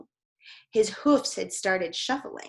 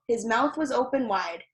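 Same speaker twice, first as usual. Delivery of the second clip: ordinary delivery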